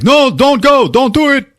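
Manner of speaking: high, loud panicked begging; acted frantic Javier; retold desperate plea. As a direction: cry out high to stop someone leaving.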